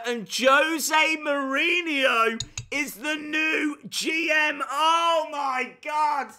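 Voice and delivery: loud, high, slow gleeful shouting; excited shout. Real, not acted. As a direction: yell it like breaking news. Gleeful shouting over own joke.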